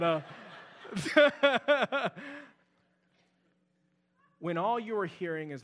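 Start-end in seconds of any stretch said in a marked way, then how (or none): 0.2-2.4 a rising burst of laughter; real laugh. Real, not acted. Pastor laughs at his own stumble before the church, real laugh. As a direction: let a real laugh bubble up. Laughs after tripping on words.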